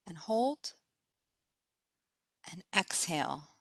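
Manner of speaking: soft, unhurried meditation voice with spaced phrases; guided meditation. Acted. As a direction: guide them gently into relaxation, pausing between phrases.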